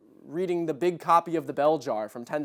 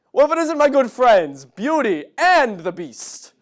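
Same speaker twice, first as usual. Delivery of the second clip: hammed-up loud greeting, starting high, slowing down; Bob Odenkirk; 6 semitones higher, 7 dB louder than usual. Bob at the Beast's castle, hammed-up greeting. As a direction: hail old friends, drawing out the title. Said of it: "He should pull up to the Beast's castle".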